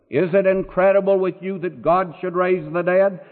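normal talking voice

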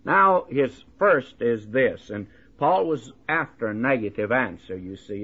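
normal talking voice

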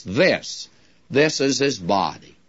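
This repeated and stressed, tune falling. Shown as a demonstration. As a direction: press on the word This. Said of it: "puts the emphasis upon hoc".

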